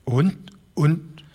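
put-on high, halting old man's stammer; lightly voiced old man stammering. A narrator's reading. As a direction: stumble over the words, flustered. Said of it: "stammelte der alte Broderson".

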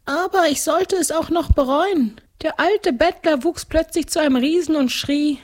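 very high ghostly storytelling voice; ghost count narrating. A narrator's reading. Ghost tells girl of his downfall, high storytelling. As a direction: recount your fate, pitched far up.

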